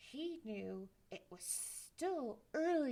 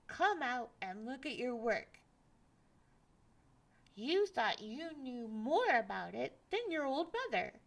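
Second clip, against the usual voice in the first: 1 angry mother; 3 semitones higher, 7 dB louder than usual. Acted. Mother storms to his bed.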